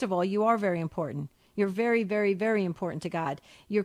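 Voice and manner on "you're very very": normal talking voice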